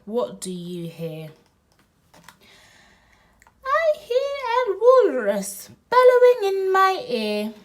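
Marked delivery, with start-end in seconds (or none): 3.7-7.5 loud, high bellow; playful loud bellow. Pretend. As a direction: bellow it out big. Walrus line shouted high.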